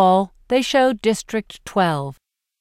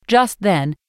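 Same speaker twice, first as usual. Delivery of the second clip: usual speaking voice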